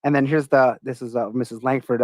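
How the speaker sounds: ordinary talk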